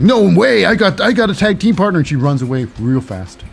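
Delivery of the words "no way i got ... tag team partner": high, loud mock protest; Candice LeRae refusing. Wrestler brushing off a would-be tag partner, high protesting voice. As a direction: turn them down in a raised, acted-out voice.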